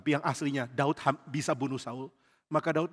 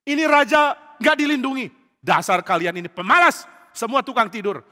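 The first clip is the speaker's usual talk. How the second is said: high, loud tirade; furious-commander outburst, acted; angry scolding; 8 semitones higher, 9 dB louder than usual. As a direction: berate the lazy guards. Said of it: "Abner marah sekali".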